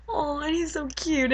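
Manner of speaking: high, melting fangirl coo; gushing coo. Retold or imitated. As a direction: swoon over a cute crush. Gushing over romantic hero.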